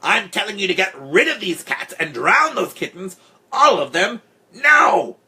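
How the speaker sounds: high, loud yowl building to a shout; angry yowled ultimatum; hard yowling voice. A narrator's reading. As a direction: order it furiously, climbing to a screech. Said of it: "in a hard, yowling voice".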